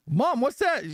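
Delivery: put-on high, curious child's question; acted kid voice, pitched well up; little boy. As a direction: ask Mom like a puzzled small boy. Joke's little boy asking his mother.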